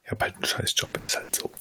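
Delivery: hushed grumble under breath; whispering. Real, not acted. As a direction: whisper it to yourself. Muttered complaint.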